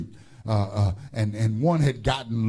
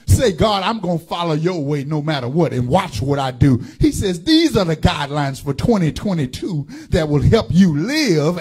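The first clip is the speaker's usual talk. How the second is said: loud, rhythmic pulpit preaching; fiery Sunday sermon delivery; preaching at full force; 2 semitones higher, 5 dB louder than usual. Real, not acted. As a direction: drive each line home to the congregation. Preaching to congregation.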